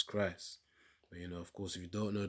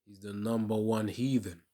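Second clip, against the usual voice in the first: loud, heated preaching; loud denunciation; 18 dB louder than usual. Real, not acted. Commentator condemning Halloween customs, loud and heated. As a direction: rail against the heathen customs.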